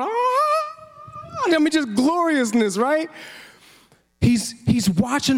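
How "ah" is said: a high, soft gasp of wonder; awed gasp. Retold or imitated. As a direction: gasp like a tiny soul seeing glory. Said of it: "her little soul inside's going".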